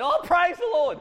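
high and loud burst of joy; exclaimed praise. Retold or imitated. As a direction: blurt it out after a great golf shot. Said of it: "And I went".

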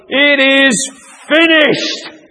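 high, ringing cry of triumph; victory cry. Acted. Jesus up on the cross as centurion listens, victory cry. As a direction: shout it as a battle won. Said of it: "a victory cry".

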